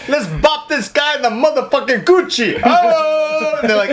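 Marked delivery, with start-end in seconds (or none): 0.1-2.6 hammed-up Italian gangster, high-pitched. Podcast guest imagining the attackers as Italians, mock Italian. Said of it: "What if it was Italian?". 2.6-3.6 high mock-Italian exclamation. Joking about Italian gangsters in the club, hammed-up Italian. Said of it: "What if it was Italian?".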